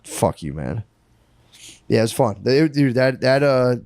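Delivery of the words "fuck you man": mock gruff jeer. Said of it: "they were just like".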